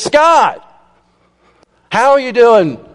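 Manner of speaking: a bright, beaming superintendent's hello; overly cheery greeting. Retold or imitated. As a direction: greet him like a beaming church elder. Cheery superintendent greets late student.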